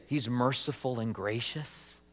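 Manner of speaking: soft, low, hesitant question; doubtful question. Acted. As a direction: ask it quietly, as if it hardly fits. Doubtful question about himself, then contrast.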